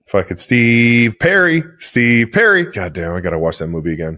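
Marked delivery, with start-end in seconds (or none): 0.1-2.6 slow, loud call-out of the name; loud excited cry. Real, not acted. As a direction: shout it out, delighted. Cracking up over a movie.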